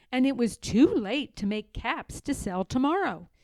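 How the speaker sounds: plain reading voice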